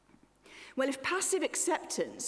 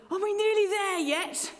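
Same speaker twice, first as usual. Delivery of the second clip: put-on high, loud whiny child in the car seat; 11 semitones higher, 7 dB louder than usual. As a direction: whine it like a bored kid in the back. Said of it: "universal cry of children".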